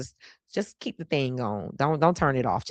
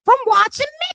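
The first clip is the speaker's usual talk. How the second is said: much higher, loud, hammed-up proud mama voice; Frederica Wilson impression; 6 dB louder than usual. Imitating Paul's mama, stumping loudly for her son. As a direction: play the queen of the town, boasting loudly. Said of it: "He made me imitate his mama".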